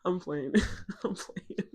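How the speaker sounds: breaking into laughter while talking; giggly, softening through laughter; laughing. Real, not acted. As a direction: laugh it off as a joke. Laughs off her joke.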